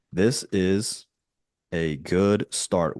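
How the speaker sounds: words stretched out with pauses; slow dictation. Real, not acted. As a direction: dictate slowly as you type. Dictating a prompt while typing.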